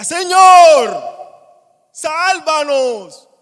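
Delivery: desperate shouted plea, dragged out slow and high; panicked cry for help. Acted. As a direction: cry out like a drowning man. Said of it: "Fue un grito que salía de su interior".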